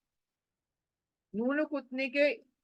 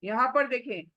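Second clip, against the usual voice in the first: usual speaking voice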